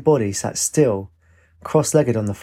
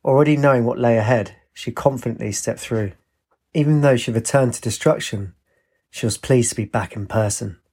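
ordinary delivery, nothing marked

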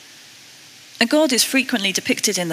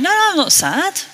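high-pitched protesting denial; insistent, higher-pitched denial; quick denial; 11 semitones higher than usual. Retold or imitated. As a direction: brush off the concern, voice jumping up. Acting out her denial to husband.

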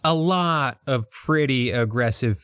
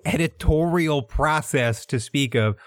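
ordinary delivery

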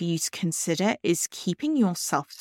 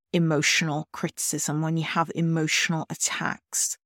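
ordinary talk, nothing marked

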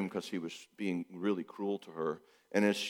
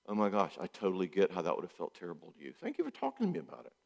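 welcoming home; 4 semitones lower, 7 dB quieter, faster than usual. Retold or imitated. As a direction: gush a tender welcome. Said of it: "We do what God does".

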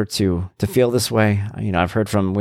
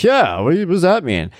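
higher, louder, defensive "what does that mean"; offended retort; 9 semitones higher, 4 dB louder than usual. Retold or imitated. Retelling how he answers friends, offended and defensive. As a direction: bristle and push back, a bit louder. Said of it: "I'm like".

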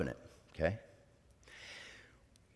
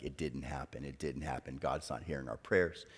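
low, subdued quote of a disheartened wife; discouraged; 5 semitones lower, 6 dB quieter than usual. Retold or imitated. Wife repeating the monthly disappointment, discouraged. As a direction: voice dropped low, quietly resigned. Retelling wife's discouraged words after months of waiting.

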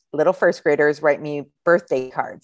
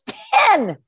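put-on high child answer; 3 dB louder than usual. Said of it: "And he goes".

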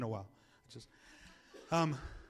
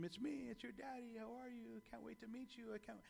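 put-on very soft, raised-pitch murmur to an unborn baby; tender hushed daddy talk; 4 semitones higher, 14 dB quieter than usual. Dad greeting his babies in the womb, tender and hushed.

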